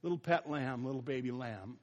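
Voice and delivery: put-on preacher softening over the little baby lamb; tender. Shown as a demonstration. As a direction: coo it gently, fading away. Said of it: "the word for lamb there is little".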